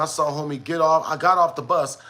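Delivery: usual speaking voice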